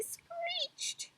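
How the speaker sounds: screeched in a high whispery squeak; screeched word. A narrator's reading. Narrating the animals' noises.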